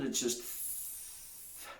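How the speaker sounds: whispered, slow th-; voiceless th. Shown as a demonstration. Teacher showing no sound, voiceless th. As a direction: breathe out th- without voice. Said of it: "It's not glottal".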